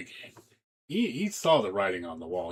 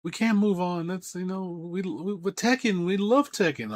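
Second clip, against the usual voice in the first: high, whiny mock plea; lightly voiced clingy fan; a hint of a desperate fan pleading; 10 semitones higher, 3 dB louder than usual. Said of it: "it's like".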